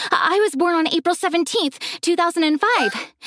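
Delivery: excited, rushed and high; breathless excitement. A narrator's reading. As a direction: gush it out eagerly. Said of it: "she was out of breath".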